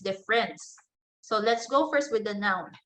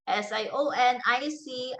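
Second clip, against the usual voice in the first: usual speaking voice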